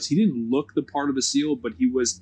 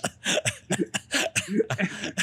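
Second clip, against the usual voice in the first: wheezing burst of laughter; high wheezing laugh; 4 dB quieter than usual. Real, not acted.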